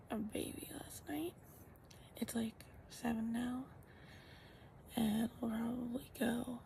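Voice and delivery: soft morning whisper. Real, not acted. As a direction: whisper so nobody wakes. Early morning, others still asleep.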